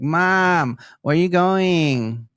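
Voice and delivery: drawn-out pester at mom; nagging kid. Retold or imitated. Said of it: "Nagging at our mom".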